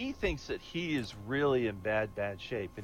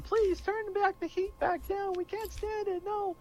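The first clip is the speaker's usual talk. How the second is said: high, slow pleading squeak; damned souls begging the devil, high pleading squeak; 3 dB quieter, slower than usual. Acted. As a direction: plead up high and slowly, like a tortured soul. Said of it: "they're begging him".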